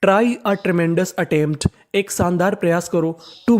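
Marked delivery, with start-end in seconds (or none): 0.1-1.7 very slow, word by word; slow dictation. Shown as a demonstration. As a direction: read the sentence slowly for the class. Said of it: "तो पूरा sentence क्या बना?". (बहुत धीरे-धीरे वाक्य पढ़ना)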